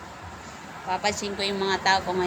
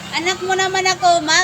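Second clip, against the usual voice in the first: higher, louder and fast playful plea; mock pleading; 8 semitones higher, 5 dB louder, much faster than usual. Streamer pleading with mom as her child, fast mock whine. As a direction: rattle off a sulky kid's plea.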